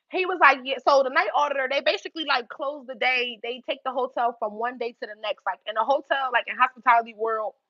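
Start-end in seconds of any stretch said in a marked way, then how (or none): none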